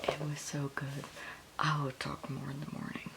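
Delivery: hushed late-night whisper; very soft, unvoiced-sounding murmur to the camera. Real, not acted. Reader updating viewers just before one A M, whispering.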